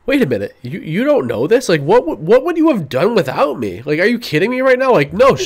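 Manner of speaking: imitating someone in high-pitched disbelief; mock-indignant, pitch climbing with each question; incredulous outburst. Podcast host mimics a character scolding the crew, incredulous. Acts out Clem berating the crew.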